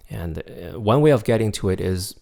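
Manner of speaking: ordinary talk, nothing marked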